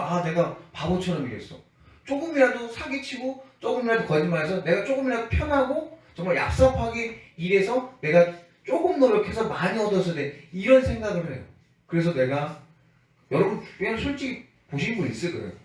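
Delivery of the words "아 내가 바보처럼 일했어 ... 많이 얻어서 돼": mock self-reproach, pitched higher; self-directed anger. Wicked worker regretting honest labor, acted mild anger. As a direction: grumble at yourself in a raised, regretful voice. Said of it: "스스로 화를 내요".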